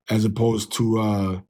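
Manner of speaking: normal talking voice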